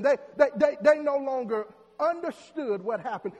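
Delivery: loud, rising sermon delivery; preaching. Acted. Preacher warns church of faith being snatched away, loud preaching. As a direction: drive it home to the congregation. Preaching builds to full force.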